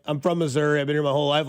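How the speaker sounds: ordinary talk, nothing marked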